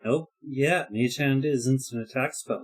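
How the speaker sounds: usual speaking voice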